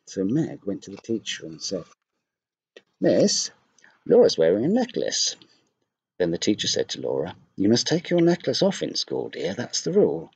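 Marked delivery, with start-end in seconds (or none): 3.0-5.4 put-on high, louder tattletale voice of a little girl; a schoolgirl telling on her classmate to the teacher; tattling girl. Meg telling on Laura. 7.6-10.3 higher, gentle teacher's voice stating the rule; a teacher firmly but sweetly telling a pupil; kindly teacher. Acted.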